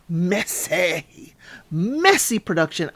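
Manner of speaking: drawn-out, relishing the word; gleeful announcement. Real, not acted. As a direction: stretch it out like a showy entrance.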